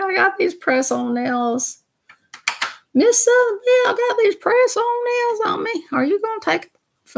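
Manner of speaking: very high-pitched mock character voice; Minnie. Minnie pesters her owner about press-on nails, very high put-on voice. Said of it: "She's like".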